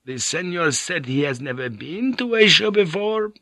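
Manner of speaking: put-on a frail old monk, relieved then bitter; relieved old priest. Old monk ranting about Jesuits.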